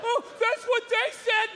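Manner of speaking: put-on confused old man. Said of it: "he's like".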